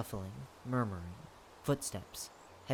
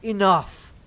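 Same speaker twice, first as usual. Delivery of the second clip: loud, higher burst of authority; commanding shout; 7 semitones higher, 12 dB louder than usual. Acted. The lord cuts off the bickering crowd, commanding shout. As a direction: silence the whole crowd with one shout.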